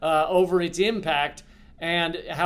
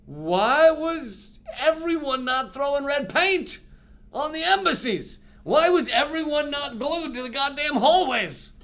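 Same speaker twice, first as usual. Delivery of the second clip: anguished disbelief; 8 semitones higher than usual. Retold or imitated. Future people looking back on today, high pleading outcry. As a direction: cry out at everyone's inaction. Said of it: "We'll be looking back and going".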